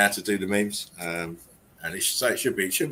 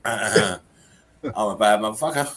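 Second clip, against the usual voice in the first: laughing; 4 dB louder, slower than usual. Real, not acted. Reading meme through laughter.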